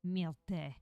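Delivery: hammed-up French cat voice; theatrical French accent, sinking to a weary aside. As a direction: lament disdainfully in a thick French accent. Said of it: "worst French accent".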